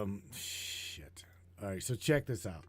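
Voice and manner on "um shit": unvoiced, breathy whisper; hushed stunned swear; whispered curse. Real, not acted. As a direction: mutter it under your breath in disbelief. Stunned, muttering to himself.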